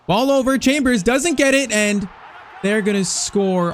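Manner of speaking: panicked rush as the defender misses; alarmed call. Real, not acted. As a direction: yelp as the danger unfolds. Opponent breaks through late.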